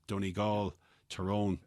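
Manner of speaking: usual speaking voice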